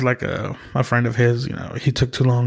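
ordinary talk

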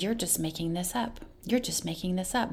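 soft, quiet, needling repetition; taunting murmur. Retold or imitated. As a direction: murmur it like a doubting thought. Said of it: "the enemy would put these thoughts in my mind".

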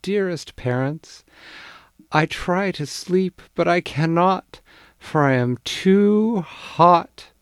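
high, loud, slowing mock-formal plea; mock-formal child. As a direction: play the child being grandly polite.

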